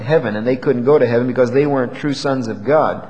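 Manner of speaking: ordinary talk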